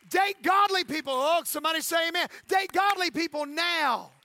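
rousing pulpit exhortation; full-force preaching. Acted. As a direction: hammer each call to action home.

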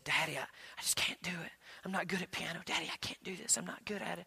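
put-on whispered, defeated little girl; hushed, tearful child voice; daughter's whisper. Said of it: "And she said".

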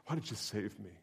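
ordinary talk

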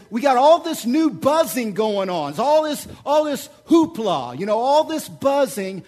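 climbing pulpit crescendo; preaching at full force. Acted. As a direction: drive the point home from the pulpit.

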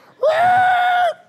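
loud, very high screech of slammed brakes; imitated brake screech. Driver telling how he slammed the brakes, mimicked screech. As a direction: screech like skidding tires.

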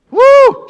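high, sustained "woo"; big high-pitched exclamation after an outburst; loud whoop. Real, not acted. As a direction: let out a piercing whoop. Reacts to the acted outburst.

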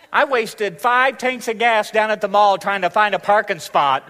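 loud, high, put-upon complaining; hammed-up martyr listing every sacrifice; grudging gift-giver. Giver complaining to receiver about the trouble, loud and aggrieved.

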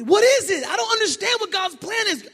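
high, loud exasperated cry; mock frustrated believer. Pastor voicing a frustrated believer, high loud cry. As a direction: shout it like a confused believer.

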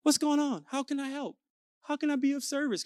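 soft, lilting offer to help; smooth and chill. Retold or imitated. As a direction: act the ever-obliging cool dad. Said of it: "I'm, like, smooth and chill".

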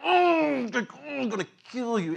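high, drawn-out threatening hum; menacing inmate. Retold or imitated. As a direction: growl a mock inmate threat. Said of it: "every inmate's like".

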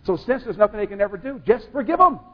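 rising to a loud, high call; preaching shout. Real, not acted. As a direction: push it out hard to the congregation. Preacher driving home forgiveness.

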